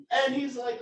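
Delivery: ordinary talk, nothing marked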